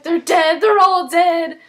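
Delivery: hammed-up panic, slowing at the end; mock panicked cry. Recapper acting the older man announcing the deaths. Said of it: "he's like".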